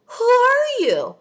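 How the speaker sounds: high, loud and slow; a high, loud, drawn-out who are you; outraged disbelief. Real, not acted. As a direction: demand it in shocked outrage. Outraged at crude pickup line.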